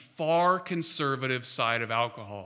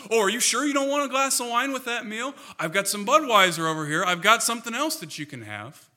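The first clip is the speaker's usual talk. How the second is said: mock pushy host; 6 semitones higher, 4 dB louder than usual. Mock pushy host offering drinks.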